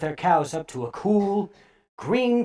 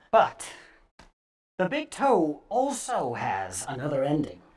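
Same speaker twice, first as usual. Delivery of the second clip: ordinary delivery, nothing marked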